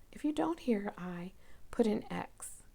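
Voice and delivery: put-on softly whispered aside to the kids; whispered instruction. Shown as a demonstration. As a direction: whisper the rule. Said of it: "teacher whispers instruction".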